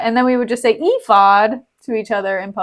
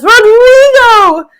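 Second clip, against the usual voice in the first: high, drawn-out theatrical shout; Graziano; 12 semitones higher, 10 dB louder, much slower than usual. Acted. Graziano recognizing the dead man, long high shout. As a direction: cry the name out long and loud. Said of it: "And Graziano says".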